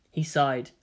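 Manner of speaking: plain reading voice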